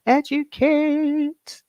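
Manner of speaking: high, long drawn-out word; high, stretched-out call of approval; sing-song cheer. Real, not acted. Cheering on a commenter.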